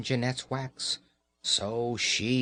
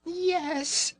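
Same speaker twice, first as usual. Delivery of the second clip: very high, tiny squeaking mouse; squeaky mouse voice. Acted. Mamma mouse pleading with Raggedy Ann, very high squeak. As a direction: squeak it out like a frightened little mouse. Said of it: "the little mouse squeaked".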